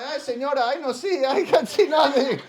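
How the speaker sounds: put-on high, loud Spanish-accented protest; hammed-up Colombian accent. Fake blind Colombian masseurs protesting.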